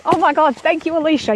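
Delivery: excited thanks. Real, not acted. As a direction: gush thanks to the commenter.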